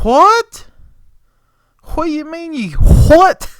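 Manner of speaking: high, loud, disbelieving what; incredulous. Retold or imitated. Boss reacts to helper's can't lift it, incredulous.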